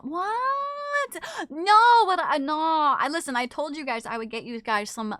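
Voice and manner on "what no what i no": high, stunned "What?" then flustered no; mock shocked. Streamer retelling her shock at the news. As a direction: sputter in disbelief. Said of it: "I was like".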